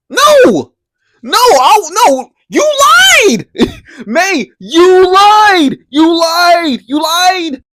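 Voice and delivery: high, loud yell of disbelief; outraged shout. Real, not acted. Outraged viewer yelling at May for lying, high and loud. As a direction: scream it at her, fed up. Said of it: "outraged at May's lying".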